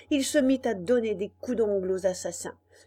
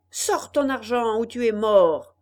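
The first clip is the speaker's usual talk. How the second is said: threatening shout; 8 dB louder than usual. Acted. Robber threatens the puppet.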